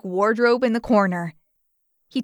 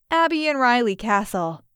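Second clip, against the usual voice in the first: ordinary delivery, nothing marked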